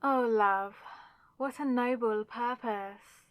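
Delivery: ordinary talk, nothing marked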